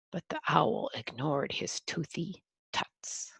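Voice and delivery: whispered narration. Acted. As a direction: hush the line to a breath.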